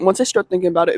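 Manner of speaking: usual speaking voice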